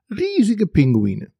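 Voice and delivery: slight grin. A narrator's reading. Narrator pulling the dragon's leg, grinning slightly. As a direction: stretch it out with a grin, starting high. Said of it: "sagte ich mit ein leichtes Grinsen".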